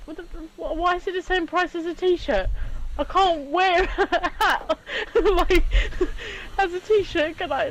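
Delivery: high-pitched, laughing through the words; laughing disbelief. Retold or imitated. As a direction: laugh at how absurd it is. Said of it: "I'm like".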